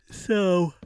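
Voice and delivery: said through a real yawn. Real, not acted. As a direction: let a yawn stretch the word. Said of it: "excuse me, yawning".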